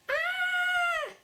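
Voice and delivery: very high, loud scream; playful scream. Shown as a demonstration. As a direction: shriek for the polar bear.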